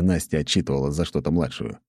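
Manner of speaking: ordinary delivery, nothing marked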